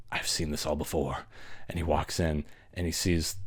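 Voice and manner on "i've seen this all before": lightly voiced Loomis, low and much softer; weary Loomis. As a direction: mumble it like a jaded old doctor who has seen it all. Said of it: "Doctor Loomis is like".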